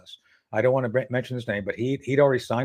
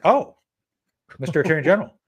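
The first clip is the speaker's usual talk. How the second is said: surprised; 5 semitones higher, 4 dB louder, faster than usual. Retold or imitated. Surprised greeting to Barr on phone.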